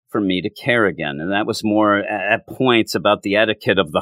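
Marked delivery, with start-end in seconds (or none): none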